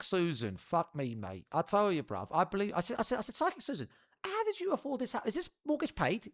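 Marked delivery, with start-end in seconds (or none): 3.4-6.3 high-pitched, nosy questioning; incredulous questioning. Retold or imitated. Client quizzing a psychic about her house, high incredulous retelling. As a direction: re-enact quizzing someone in a high, amazed voice.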